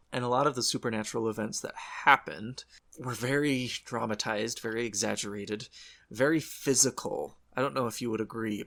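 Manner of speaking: normal talking voice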